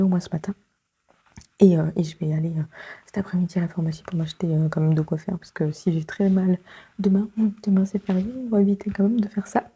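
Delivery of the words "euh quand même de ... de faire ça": ordinary talk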